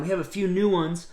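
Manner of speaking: ordinary delivery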